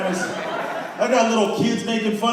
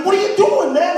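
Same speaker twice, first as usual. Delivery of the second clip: much higher exasperated shout; alarmed yell; 11 semitones higher, 4 dB louder than usual. Retold or imitated. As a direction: yell at him as the director flees. Director runs away.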